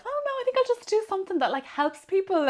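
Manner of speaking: high, loud, sweetly idealistic; mock earnest naivety. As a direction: play her younger self, wide-eyed and sincere. Her young self answering dad.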